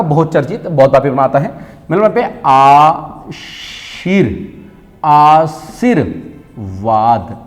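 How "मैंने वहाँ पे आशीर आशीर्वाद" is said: आशीर्वाद drawn out slowly, syllable by syllable; slow pronunciation demo. Shown as a demonstration. Teacher sounds out the exam word slowly. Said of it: "आपका शब्द आपका ऐसा है आशीर्वाद".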